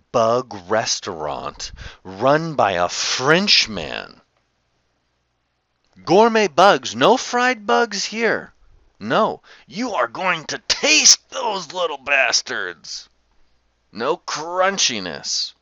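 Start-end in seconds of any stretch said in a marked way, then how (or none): 6.0-15.5 high, loud hyped pitch; hammed-up bug promo; mock announcer. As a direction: sell gourmet bugs like an ad. Mock announcer about bugs.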